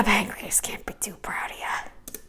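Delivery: whispered aside; breathy muttered aside. Real, not acted. Aside about pancreas.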